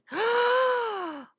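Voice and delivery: sharp loud gasp; loud shocked gasp. Real, not acted. Shocked by new story.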